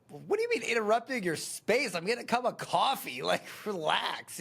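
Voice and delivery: a guy brushing off a fuss, mock exasperated; mock exasperated man. A husband answering the wife's complaint, mock exasperated. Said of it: "I just wanna be like".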